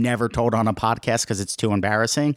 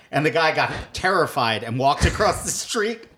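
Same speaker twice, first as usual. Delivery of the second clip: ordinary talk, nothing marked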